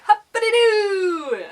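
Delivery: high, loud, drawn-out whoop; Tigger. Acted. Asking if viewers feel bouncy, high whooping cartoon voice. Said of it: "Or are you Tigger?".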